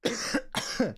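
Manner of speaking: breathy hacking cough; coughing on dust. Real, not acted. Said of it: "attacked from the inside out".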